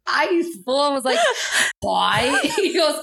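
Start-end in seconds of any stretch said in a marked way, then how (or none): 1.8-2.3 badly faked English "Boy" for pie; botched English accent. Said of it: "say it in my accent".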